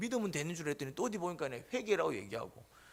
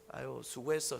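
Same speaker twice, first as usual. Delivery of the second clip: softer, lower, kind pat on the back; gentle praise; 7 semitones lower, 4 dB quieter than usual. Retold or imitated. Changed man praising someone gently. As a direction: soothe a tired helper tenderly.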